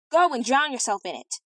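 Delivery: sharp, rude dismissal to the chamberlain; rude retort. Acted. Princess to nightcapped chamberlain, rudely provoked. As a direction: snap it back at him, fed up. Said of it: "This was the last rudeness".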